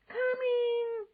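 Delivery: loud high answering shout. A narrator's reading. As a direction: shout back out the window.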